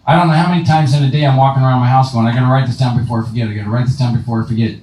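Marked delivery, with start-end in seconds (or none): 2.3-4.8 rushed self-reminder. Retold or imitated. Man repeating reminder to himself.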